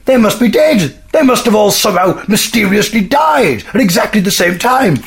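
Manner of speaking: high mocking bear voice with laughs; a gloating bear sneering at the humans; scornful bear. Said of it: "We're entering the mind of a bear".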